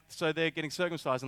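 ordinary talk, nothing marked

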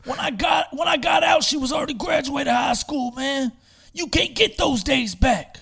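high, loud impassioned yell; ex-prisoner yelling his story to a room of kids; retold shout. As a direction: shout it like a man who lost twenty years. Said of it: "He's like".

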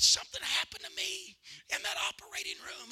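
whispered, secretive retelling; hushed confession. Retold or imitated. Grandfather telling the pastor his secret, whispered. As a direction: lean in and whisper it.

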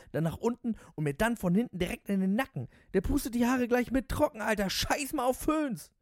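high-pitched gushing, building to a cry; raving cabbie. Acted. Voices the raving taxi driver.

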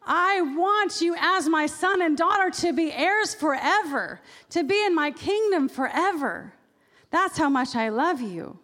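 slow, lifted tender declaration; a hint of a father's voice reassuring his child; tender promise. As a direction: draw out each word like a loving vow. Voicing God's tender promise.

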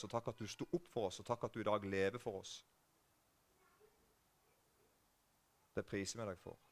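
low, soft prayer voice. Real, not acted. Preacher thanking Jesus before the congregation, hushed prayer. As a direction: pray quietly, pausing between phrases.

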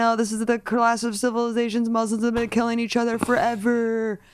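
mock the alt-right pundits' alarm; mocking online bigot. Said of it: "hears that someone said".

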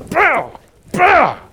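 sharp high loud strike noises; whack sound. Retold or imitated. Imitating Moses hitting the rock with his staff, sharp loud whacks. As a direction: smack the rock with your voice.

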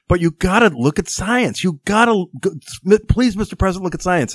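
high, whiny begging plea; earnest pleading bureaucrat. Retold or imitated. The aide pleading with the president about science, high whiny begging. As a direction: beg him like a desperate young aide. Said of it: "the earnest bureaucrat went back and said".

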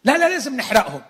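hammed-up zealous yell; mock shout. Disciple insists on burning.

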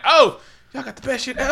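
high, loud hammed-up party voice; laughing mock boast over strong liquor; mock tipsy brag. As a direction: ham it up like a tipsy barfly. Imagined drinking scene.

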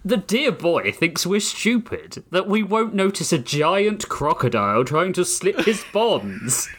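British villain mocking the captive crocodile, in a slow British accent. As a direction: drawl it like a smug British gentleman. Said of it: "a distinctly British voice".

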